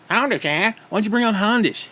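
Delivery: put-on horrible Hondish accent. Bringing up a fellow streamer, mock accent. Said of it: "Fucking horrible accent".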